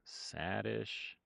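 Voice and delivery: ordinary delivery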